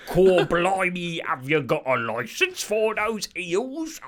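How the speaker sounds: loud, high, drawn-out Cockney caricature; hammed-up stage Cockney; mock Cockney accent. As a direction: belt out a terrible, exaggerated Cockney. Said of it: "In that language would be like".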